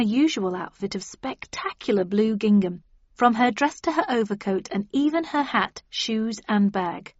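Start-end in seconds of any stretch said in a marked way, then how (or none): none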